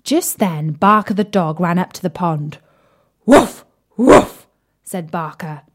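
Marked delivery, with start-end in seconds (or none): 3.3-4.5 loud barky woof, higher; dog barking. Acted. Barker the dog barking at the pond. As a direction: bark it like a big bouncy dog.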